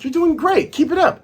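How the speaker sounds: high cheery Nami voice. Retold or imitated. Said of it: "Nami's like".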